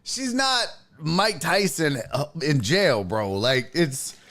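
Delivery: ordinary delivery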